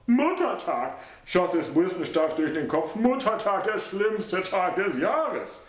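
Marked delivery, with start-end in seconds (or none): 0.1-1.0 lightly voiced, alarmed son; son's voice, dismayed. A narrator's reading. 3.0-5.6 lightly voiced, groaning son; son's voice, rueful. A narrator's reading.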